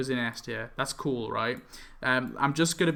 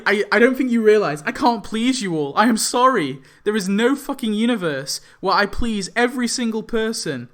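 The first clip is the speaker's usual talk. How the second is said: louder, higher, exasperated; exasperated apology; 6 semitones higher, 4 dB louder than usual. Real, not acted. Vlogger telling commenters he can't please all, exasperated. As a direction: say sorry while fed up.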